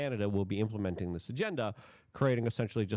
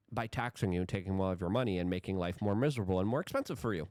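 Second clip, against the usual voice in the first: ordinary talk, nothing marked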